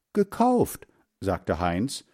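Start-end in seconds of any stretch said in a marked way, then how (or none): 0.1-0.9 GEKAUFT said very high, as an answer; boy piping up with a high reply; high-pitched answer. A narrator's reading. First boy answers mother's question. (hohe, kindliche Antwort)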